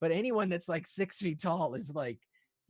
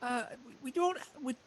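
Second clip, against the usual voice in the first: hesitant halfling hostess; 9 semitones higher than usual. Acted. Hostess admitting she has little food, hesitant. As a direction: falter politely, a little embarrassed.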